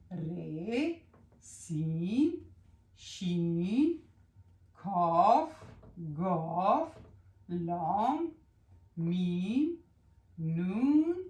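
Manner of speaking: each letter called out loud and separate, with a pause after it; reciting letters. Shown as a demonstration. Teacher reciting the consonants for pupils to copy, loud and deliberate. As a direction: call out each letter loud and clear, one by one. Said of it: "حرفای بی صدا عبارت از".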